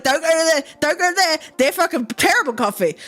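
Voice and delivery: mock gossip. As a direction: nag like an old regular warning friends. Old customers gossiping, retold.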